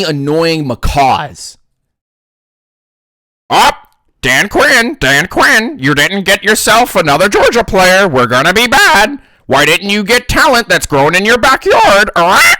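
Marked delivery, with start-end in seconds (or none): 3.5-12.5 loud, high whiny fan; squawking fan. Retold or imitated. Ranting fan yelling at Dan Quinn, loud and high.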